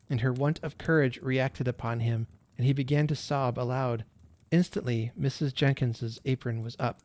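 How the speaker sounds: usual speaking voice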